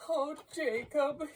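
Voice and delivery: high-pitched pleading Scrooge; imploring. A narrator's reading. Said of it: "he said imploringly".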